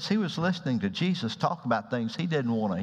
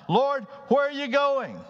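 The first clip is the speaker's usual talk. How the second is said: impulsive blurt; 11 semitones higher than usual. Acted. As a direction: burst out with it, much higher. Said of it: "blurted out".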